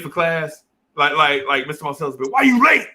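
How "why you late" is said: high, loud demand from the teacher; teacher's scolding question. Retold or imitated. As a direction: snap the question like a strict teacher. Teacher scolding late student.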